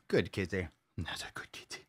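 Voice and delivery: soft whispered good kitty; whispered praise. Real, not acted. Owner whispering praise to the dancing kitty. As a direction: breathe the praise to the cat.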